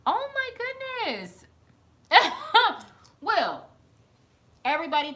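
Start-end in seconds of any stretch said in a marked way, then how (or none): 2.1-2.8 loud high laugh bursting out; a sudden loud, high cackle of laughter. Real, not acted. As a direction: crack up loudly. Laughs at her oh my goodness.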